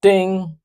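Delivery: high bright ding imitation; microwave ding. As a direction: chime like a timer going off. Imitating microwave timer bell.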